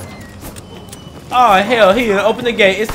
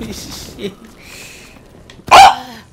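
usual speaking voice

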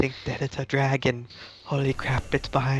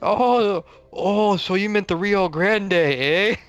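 put-on teasing Canadian accent, higher and louder with a big "eh?"; high, loud mock Canadian, tacking on "eh?"; 7 semitones higher, 5 dB louder, slower than usual. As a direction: poke fun at a friend with a Canadian "eh". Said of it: "it's just my Canadian accent".

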